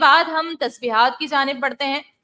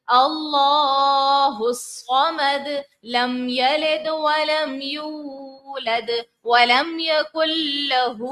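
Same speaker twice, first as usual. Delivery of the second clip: slow melodic tajweed recitation; slow chanted Quranic recitation; much slower than usual. Shown as a demonstration. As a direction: recite slowly with full tajweed. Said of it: "ایک بار پڑھ کر پہلے دکھا دیتی ہوں".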